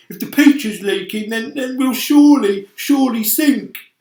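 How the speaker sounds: high loud stammering panic; panicky earthworm. Acted. Earthworm fearing they will sink, high loud panic. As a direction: stammer out doom.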